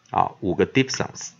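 ordinary talk

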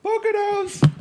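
high yelled Poconos; shouted Poconos. Acted. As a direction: belt it out like a call back. Call and response bit.

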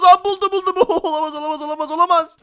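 high panicked laughing yells; being chased. Pretend. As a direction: panic and laugh as it catches you. Zombie finds him in house.

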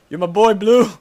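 laughing movie-line shout; laughing quote. Pretend. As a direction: bellow it through a laugh.

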